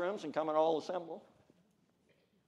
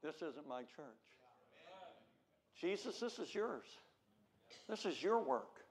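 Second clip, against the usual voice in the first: soft, surrendered aside to Jesus; hushed humble confession; humble quiet prayer; 15 dB quieter than usual. Retold or imitated. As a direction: hand it over quietly. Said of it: "if people would go".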